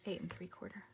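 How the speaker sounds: whispered measurement aside; note it under your breath; hushed, talking to yourself; muttering to self. Real, not acted. Measuring aloud to herself mid-story.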